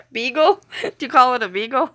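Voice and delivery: "Beagle" with a long ee, rising; teasing mispronunciation. Shown as a demonstration. Streamer quizzing a teasing viewer, "Beagle" with long ee. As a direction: ask "Beagle?" as bee-gull, amused. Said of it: "What do you call it?".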